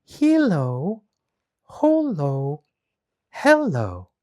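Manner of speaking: high spell words, each set apart; slow, spaced magic words; read slowly. Acted. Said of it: "read slowly".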